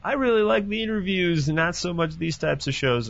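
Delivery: lightly imitating a disappointed listener; mock fan complaint. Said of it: "anybody who is sort of like".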